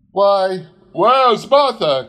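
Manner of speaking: high, loud, drawn-out call; cheerful cry. A narrator's reading. Bob comes home and calls for Martha, loud and high. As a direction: call out gladly for your daughter. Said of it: "cried Bob Cratchit".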